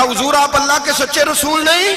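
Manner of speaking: high-pitched pleading question; agitated challenge. Acted. Umar challenging the Prophet.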